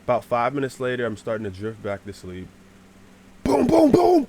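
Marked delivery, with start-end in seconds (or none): 3.4-4.3 loud, high booms; explosive vocal bangs; imitated thumping. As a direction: boom out the sudden racket overhead. Imitates squirrels thumping on roof.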